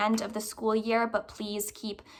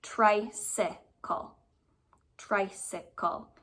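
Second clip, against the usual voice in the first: drawn out slowly, syllable by syllable; careful slow sounding-out; slow syllable count; much slower than usual. Shown as a demonstration. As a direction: stretch tricycle out slowly to hear its beats. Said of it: "But I look at this".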